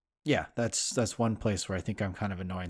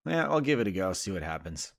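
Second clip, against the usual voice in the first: usual speaking voice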